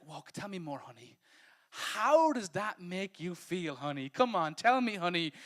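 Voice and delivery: husband at dinner coaxing his wife, mock tender. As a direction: play the syrupy, overly caring husband.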